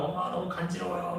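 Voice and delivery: put-on hint at a ticklish child talking to mom; lightly voiced child. Child reacting to mother's nibbling, lightly voiced child. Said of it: "막 그러는 거예요".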